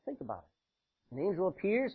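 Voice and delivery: usual speaking voice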